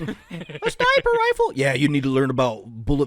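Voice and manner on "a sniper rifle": high squeaky surprised echo; disbelieving, laughing repeat; surprised question. Acted.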